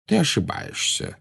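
soft, offhand contradiction; soft, easy, as if chatting over beer; casual. A narrator's reading. Dumbledore correcting Tom mid-duel, casual. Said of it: "Тон его был так небрежен".

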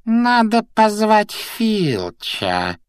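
high, very slow musing; scheming, sing-out drawl; Peeves, thoughtful. A narrator's reading. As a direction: draw it out in a high poltergeist voice. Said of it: "задумчиво проговорил Пивз".

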